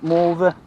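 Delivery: morver, mother said Norfolk-style with a v for th; Norfolk pronunciation. Shown as a demonstration. Fisherman showing Norfolk dialect, morver with v. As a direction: say morver, v in place of th. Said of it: "or more, marv".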